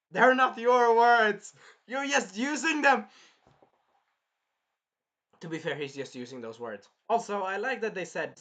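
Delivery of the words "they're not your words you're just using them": high, loud, drawn-out protest; hammed-up outrage, hitting each word; indignant mock scolding. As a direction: shout it as an offended correction. Mock scolding over borrowed quote.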